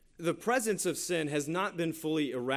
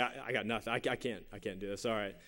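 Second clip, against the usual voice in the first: low, soft muttering to himself; frustrated; 6 semitones lower, 7 dB quieter, faster than usual. Retold or imitated. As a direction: give up quietly, in halting low words. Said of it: "I'm sitting there frustrated".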